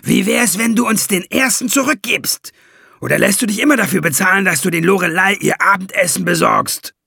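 a cocky dwarf taunting the greedy ferryman; scornful high dwarf voice; mocking dwarf.

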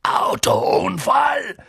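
langsam und laut gedonnert; empört herausdonnern; donnernd. A narrator's reading. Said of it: "donnerte Hagrid". (Hagrid donnert fassungslos los)

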